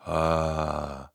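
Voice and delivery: low, soft, relaxed "ah"; sustained ah on the out-breath; voiced exhale. Shown as a demonstration. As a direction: sigh out a long low tone. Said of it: "put a little voice on top of your exhale".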